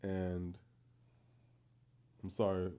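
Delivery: normal talking voice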